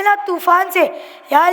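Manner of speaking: ordinary talk, nothing marked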